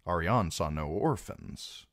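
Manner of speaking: usual speaking voice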